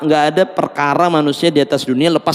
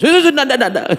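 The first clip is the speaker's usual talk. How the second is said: wife refusing; 11 semitones higher, faster than usual. Acted. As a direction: cut him off as the wife.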